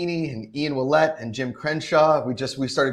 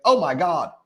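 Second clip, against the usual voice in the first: high, loud, amazed Southern gush; excited Atlanta local; 12 semitones higher, 8 dB louder than usual. Retold or imitated. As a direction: gush like a thrilled local homeowner. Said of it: "He's like".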